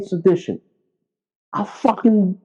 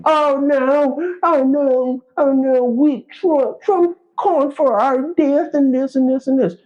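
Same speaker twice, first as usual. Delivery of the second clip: higher, whiny mock panic with a fake sob; mock whining; 6 semitones higher than usual. Mocking Democrats who complain about Trump, whiny fake crying. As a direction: mimic a crybaby wailing "oh no".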